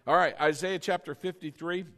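ordinary talk, nothing marked

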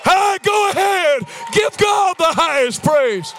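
high, loud, drawn-out preaching shout; full-force exhortation. Real, not acted. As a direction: urge the crowd into praise.

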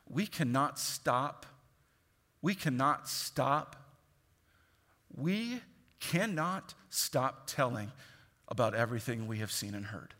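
very slow, quiet insistence; slow firm resolve. Acted. Preacher echoing the apostles' refusal, slow and quiet. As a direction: lay each word down deliberately. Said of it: "slow repeated declaration".

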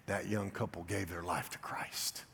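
soft, slow, reverent hush; awed whisper. Real, not acted. Pastor telling the church of the couple's salvation, whispering in awe. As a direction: let the wonder hush you.

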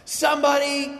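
high, loud mock cry for help. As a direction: call out like a frightened girl. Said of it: "crying out for somebody".

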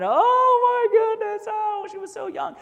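high, loud hammed-up wail; exaggerated professional crier lament; mock wailing mourner. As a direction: ham up a hired mourner's cry. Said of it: "they would just scream and yell".

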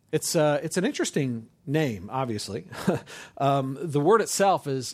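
usual speaking voice

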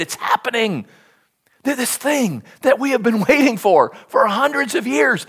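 ordinary talk, nothing marked

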